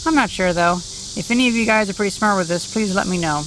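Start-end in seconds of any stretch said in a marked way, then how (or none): none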